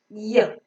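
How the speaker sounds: "y" as a short "yuh"; a lone "yuh" glide for "y"; phonic Y sound. Shown as a demonstration. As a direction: say the sound of "y", not the letter name. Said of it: "The letter Y is pronounced as y".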